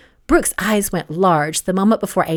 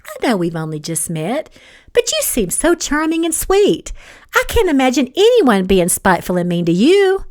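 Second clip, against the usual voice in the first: sugary mock innocence, lifting high; syrupy, swooping insincere flattery; honeyed sweetness; 6 semitones higher than usual. A narrator's reading. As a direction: lay on the fake sweetness. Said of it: "words flowed out like honey".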